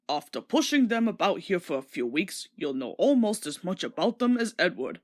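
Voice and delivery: lightly voiced stern fat director. A narrator's reading. Fat director teaching little Thomas, lightly voiced and firm. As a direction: lecture him kindly but firmly.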